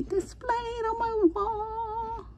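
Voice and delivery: high sing-song coo. Real, not acted. Collector cooing at her new figure, high sing-song. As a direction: coo it like talking to a pet.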